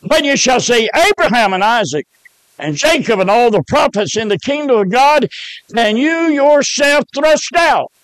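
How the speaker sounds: rising to a high preached cry; preaching scripture. Acted. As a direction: build the verse up to a shout on "thrust out". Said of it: "And it said".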